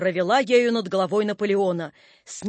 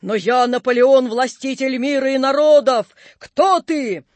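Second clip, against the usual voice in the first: loud, higher, grand declamation; defiant proclamation; 4 semitones higher, 5 dB louder than usual. A narrator's reading. Napoleon defies the apparition, loud proclamation.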